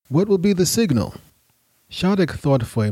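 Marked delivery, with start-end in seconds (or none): 0.1-1.1 lightly voiced questioning younger man. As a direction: ask for the plan.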